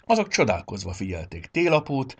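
plain reading voice

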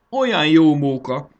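high, loud happy cry; flushed cry of joy. A narrator's reading. Fanny cries out with joy while sowing, high and loud. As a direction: call out with pure fun. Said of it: "kiáltotta kipirulva".

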